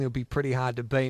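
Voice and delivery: ordinary delivery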